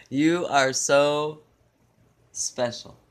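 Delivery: hammed-up lover lingering on "You are so"; slow swooning emphasis. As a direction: swoon over each word of "You are so". Mock romance, repeated swooning.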